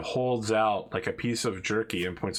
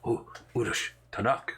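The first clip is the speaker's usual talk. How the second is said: hushed, eager orc speech; excited orc whisper. Acted. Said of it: "he's like".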